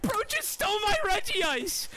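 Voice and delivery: high, loud, stunned cry; stunned outrage. Real, not acted. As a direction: cry out at the stolen Regice. Regice stolen.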